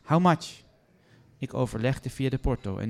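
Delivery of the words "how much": loud, high, demanding repeat; insistent repeat. Acted. Guest pressing the receptionist for a price, loud insistent demand. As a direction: hammer the question home. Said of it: "herhaalde haar vraag".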